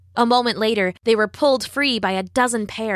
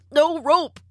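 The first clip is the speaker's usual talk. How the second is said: higher, strained words between coughs; acted coughing prince; coughed-out words; 6 semitones higher than usual. A narrator's reading. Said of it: "he coughed".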